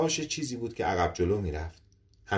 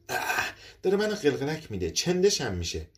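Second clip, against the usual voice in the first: higher, squirming disgust at being tickled; squeamish giggle; 7 semitones higher than usual. Acted. Woman tickled by metal antenna.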